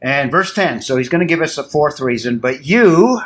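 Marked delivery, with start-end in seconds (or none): 2.4-3.2 "you" punched high and loud; stress on you. Shown as a demonstration. Teacher stressing "you" in Paul's question to the class. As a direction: lean hard on "you" as an emphatic accusation. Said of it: "he's very direct here, it's emphatic".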